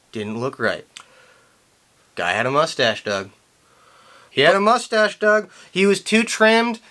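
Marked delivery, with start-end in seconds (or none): none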